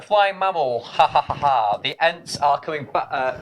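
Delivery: ordinary delivery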